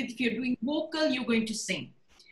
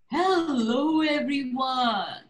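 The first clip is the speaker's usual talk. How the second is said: storyteller voice; much slower than usual. Shown as a demonstration. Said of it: "It's just the voice and the expression".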